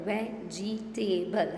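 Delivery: "Vegetable" slowly as ve, ge, ta, ble; teacher drawing out each syllable of "Vegetable"; split into syllables. Shown as a demonstration. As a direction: say "Vegetable" in four separate beats. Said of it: "How many syllables?".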